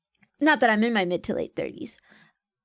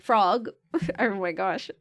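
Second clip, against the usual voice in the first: ordinary delivery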